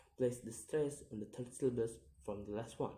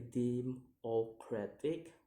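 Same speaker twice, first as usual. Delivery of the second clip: "democratic" slowly split into syllables; slow, separated syllables on "democratic"; slower than usual. Shown as a demonstration. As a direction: sound out "democratic" piece by piece. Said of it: "count the word syllable backwards".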